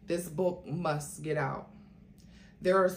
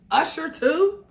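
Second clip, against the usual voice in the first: much higher, louder shocked question; revulsion; 11 semitones higher, 10 dB louder than usual. Acted. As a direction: recoil in disgust. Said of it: "Kimora said with revulsion".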